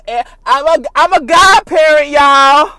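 loud, high, drawn-out announcement; shouting proudly with happy tears; excited shout. Real, not acted. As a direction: announce it with joy. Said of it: "let me wipe my tears".

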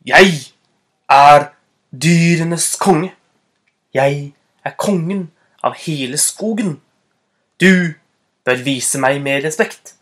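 loud, deliberate opening words, firm throughout; self-important king demanding obedience; commanding declaration. Acted. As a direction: assert your royal rank, pausing for weight. Fox bluffs the tiger as king.

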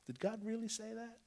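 a hint of a high, hushed serpent taunt; sly serpent.

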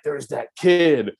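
mock astonished recognition. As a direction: imitate gawking schoolmates spotting someone. Older students pointing him out.

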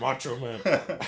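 slow, high, loud mock macho voice; macho man. Joking with the host about never crying, mock macho. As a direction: ham up a tough guy who never cries. Mocking his macho self.

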